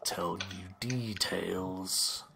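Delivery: low, slow whispered dragon; an ancient dragon whispering orders. Acted. Dragon dismisses the party.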